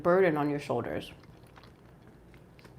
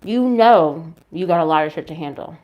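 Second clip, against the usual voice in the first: loud emphatic; 8 dB louder than usual. Real, not acted. Emphatic tarot reading aside.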